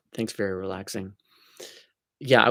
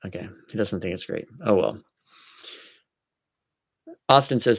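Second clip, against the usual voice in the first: ordinary talk, nothing marked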